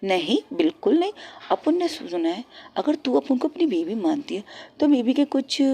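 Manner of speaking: ordinary delivery, nothing marked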